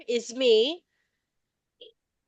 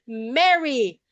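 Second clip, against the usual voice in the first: usual speaking voice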